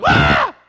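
put-on loud sudden baby cry; baby shout. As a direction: blurt it out like a startled baby. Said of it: "what it say".

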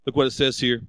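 usual speaking voice

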